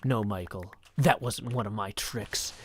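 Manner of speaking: low impression of an illusionist correcting Michael; Job. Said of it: "I do an excellent Job impression".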